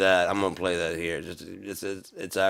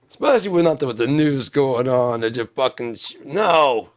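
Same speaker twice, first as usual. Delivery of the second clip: loud, raised exasperation; worked-up rant; 6 semitones higher, 8 dB louder than usual. Acted. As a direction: push the complaint out loudly. Rant goes on about the news.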